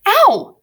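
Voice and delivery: high, loud "ow"; pained yelp. Acted. Prentice hit by Grumpkin's fist, sharp pained yelp. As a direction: yelp as if struck on the ear.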